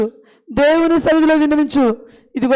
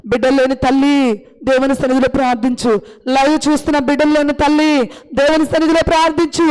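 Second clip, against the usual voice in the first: normal talking voice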